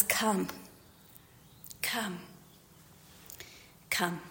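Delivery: very soft, hushed invitation; low, tender near-whisper; gentle urging. Acted. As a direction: beckon quietly, with long pauses between. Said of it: "In contrast to this loud and constant play".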